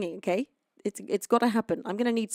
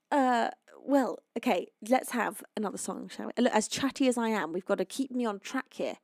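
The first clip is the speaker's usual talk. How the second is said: ordinary talk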